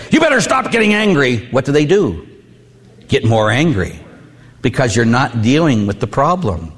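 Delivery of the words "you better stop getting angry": high-pitched scolding demand; imitated cross telling-off; mock scolding. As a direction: act out nagging someone to quit it. Acting out scolding an angry husband.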